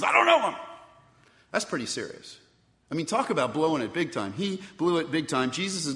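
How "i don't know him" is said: sudden high, loud shout of denial; cursing outburst. Acted. Peter swearing to the crowd that he never knew Jesus, loud shout. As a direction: fling the denial out as a cursed oath. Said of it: "he curses and he swears and he says".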